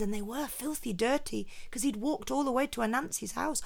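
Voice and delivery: plain reading voice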